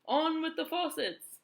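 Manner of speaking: high, very loud, slow booming call; shouting cloud man. A narrator's reading. Cloud man ordering the rain, booming slow shout. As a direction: bellow it down from the clouds. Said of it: "it shouted".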